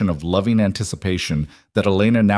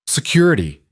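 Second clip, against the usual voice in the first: shouting; 6 semitones higher than usual. Acted. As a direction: yell for the guards. Caleb calls for guards.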